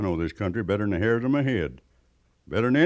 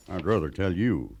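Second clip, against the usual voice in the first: normal talking voice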